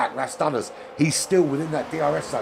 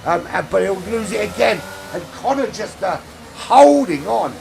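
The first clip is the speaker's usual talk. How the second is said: much higher and louder, shouting the overtake; excited race call; 8 semitones higher, 7 dB louder than usual. Real, not acted. Commentator calling the overtake for the lead, shouting with excitement. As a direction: yell it as he takes the lead.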